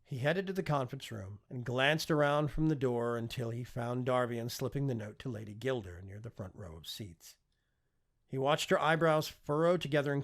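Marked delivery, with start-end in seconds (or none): none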